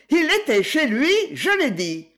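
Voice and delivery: high, loud dogged insistence; stubborn insistence. A narrator's reading. Wife insisting stubbornly, high and loud. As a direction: dig in and insist. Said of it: "avec une obstination tenace".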